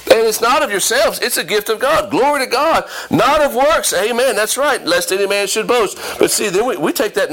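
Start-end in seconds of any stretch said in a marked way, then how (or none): 0.1-6.0 high-pitched, rousing scripture quotation; fervent preaching. Acted. Preacher quoting a well-known verse to the church, fervent preaching. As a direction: ring out the verse with amens thrown in.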